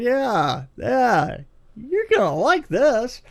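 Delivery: imitating the manager's ominous high slow promise; ominous knowing tone. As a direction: tease that bad news is coming. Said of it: "in that way that he said it".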